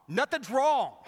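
hammed-up irritated denial; mock snapping wife. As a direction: snap it like an annoyed wife.

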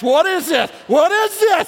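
high, loud, rising panic; mock freaking out. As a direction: build to a hammed-up shriek of dismay. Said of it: "some of you freaking out".

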